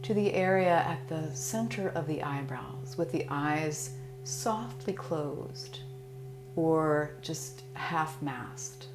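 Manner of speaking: calm, soothing Yoga Nidra guidance; guided meditation. Acted. Teacher guiding listeners through Yoga Nidra, calm meditation voice.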